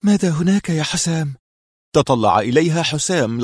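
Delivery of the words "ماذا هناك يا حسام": high, anxious question; raised pitch, uneasy dread; trembling voice. A narrator's reading. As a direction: ask with fearful apprehension. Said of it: "وارتجف صوتها وهي تقول".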